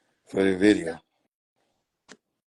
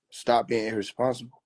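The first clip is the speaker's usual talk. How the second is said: ordinary talk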